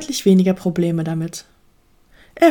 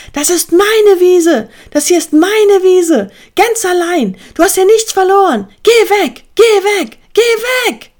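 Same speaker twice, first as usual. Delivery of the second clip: high, loud furious yelling; raging at full voice; 11 semitones higher, 7 dB louder than usual. A narrator's reading. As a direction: lose control and scream the intruder away. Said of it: "tobte Adi lauthals".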